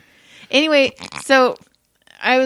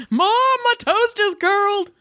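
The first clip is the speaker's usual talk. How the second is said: put-on high, slow, wide-eyed little boy; mock kid voice; 11 semitones higher, slower than usual. A little boy telling his mom, in a high, slow, stunned voice.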